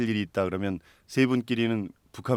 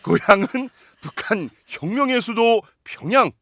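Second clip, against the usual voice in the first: announcer voice; 4 semitones higher, 4 dB louder than usual. Acted. Host introduces a guest.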